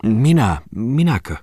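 caught-off-guard high stammer; flustered. Acted. Aapeli caught off guard by the lady, flustered. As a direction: fumble the answer, a bit embarrassed. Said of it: "vastasi Muttinen hiukan hämillään".

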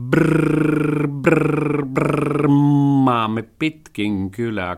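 rolled brrr engine imitation; motorbike noise. A narrator's reading. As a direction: roar like a motorbike, slowly. (moottoripyörän pörinä)

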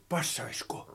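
hushed breathy whisper, no voice. Acted. Santeri whispers his question in the quiet cabin, hushed whisper. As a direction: whisper so nobody hears. Mä yritän liikkua hiljaa.

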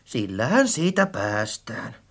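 low, soft, offhand remark; casual resolve. A narrator's reading. Friend settles on buying anew, casually.

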